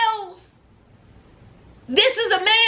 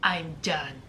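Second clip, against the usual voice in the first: flat quiet; 13 semitones lower, 10 dB quieter than usual. Retold or imitated. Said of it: "Gino says".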